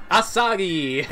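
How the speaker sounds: loud, high character-name cry; hammed-up anime-style name call; mock name shout. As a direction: call the name out big, playfully. Calls out character name, then laughs.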